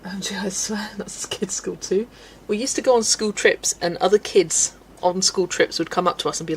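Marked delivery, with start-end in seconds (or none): 0.0-2.1 whispered OMG; soft whispered aside. Real, not acted. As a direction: breathe it like a secret. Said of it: "whispered aside".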